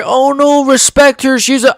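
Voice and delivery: mocking fans. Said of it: "I don't wanna hear".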